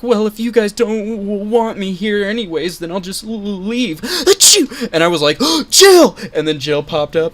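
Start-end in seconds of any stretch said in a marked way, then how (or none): 0.0-4.0 shy high stutter; high stammering girl voice. Retold or imitated. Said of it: "she's just like". 4.0-4.9 loud high fake sneeze; mock sneeze. Imitated sneeze. 5.4-6.2 loud high cheer; excited shout. Retold or imitated. Excited calling out.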